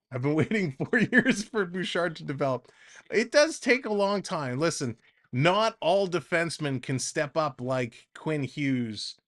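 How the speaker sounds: normal talking voice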